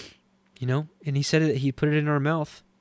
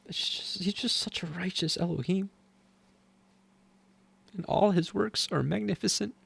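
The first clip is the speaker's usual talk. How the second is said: soft, high, choked-up awe; really moved, voice hushed and lifted; emotional; 5 semitones higher, 5 dB quieter than usual. Real, not acted. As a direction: praise while holding back tears. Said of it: "why I'm getting emotional".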